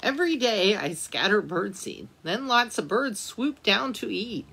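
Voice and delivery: usual speaking voice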